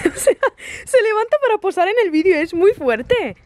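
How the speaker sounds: talking through giggles, high; laughing. Real, not acted. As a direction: laugh while you say it. Riendo ante el perro posando. (riendo mientras habla)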